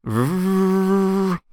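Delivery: high, sustained vocal engine rev; drawn-out, playful "vroom" hum; motorcycle engine noise. Pretend. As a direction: imitate a motorbike with your mouth. Imitating a motorcycle engine starting.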